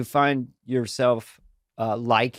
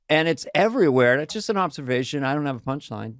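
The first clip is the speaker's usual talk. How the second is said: usual speaking voice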